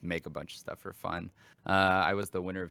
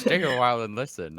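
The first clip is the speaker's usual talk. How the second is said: put-on a light, loud try at an old sage; first stab at the wise old storyteller; Deckard Cain voice; 5 semitones higher, 8 dB louder, faster than usual. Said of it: "I'm Deckard Cain".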